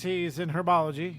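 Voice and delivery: normal talking voice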